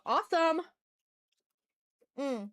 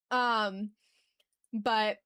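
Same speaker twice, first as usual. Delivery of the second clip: ordinary talk, nothing marked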